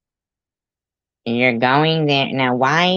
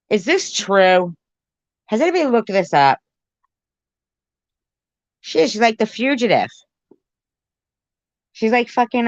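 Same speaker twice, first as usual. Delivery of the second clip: higher and quicker, amazed; amazed excitement; 8 semitones higher than usual. Real, not acted. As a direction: wind up with disbelief. Listener amazed by the fugitive tale.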